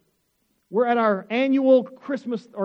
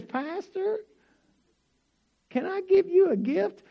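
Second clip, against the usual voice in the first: imitating the kind lady; 10 semitones higher, slower than usual. Stranger offering the pastor a gift, high mock lady voice. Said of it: "And she says".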